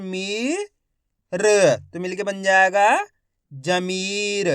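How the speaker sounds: slow, stretched syllables blended into Zameer; drawn-out sounding out. Shown as a demonstration. Teacher blending letters into a word for learners, stretched out. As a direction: hold the long ई in Zameer. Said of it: "बड़ी ई की मात्रा को खींच के पढ़ा जाता है".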